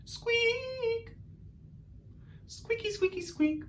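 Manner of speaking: very high, soft squeaks; tiny mouse-like squeaking; Artie squeaks. Shown as a demonstration. Teacher leading class in Artie squeaks, very high. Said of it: "our best Artie squeaks".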